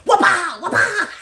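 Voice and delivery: high, loud whooping wallops; imitated wing beats. Imitating the rooster beating her with his wings, whooping. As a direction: punch out each whoop like a wing slap. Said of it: "He was like".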